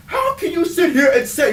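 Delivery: high voice with a crying edge; mock whining rant. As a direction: whine it in comic despair. Co-host still ranting.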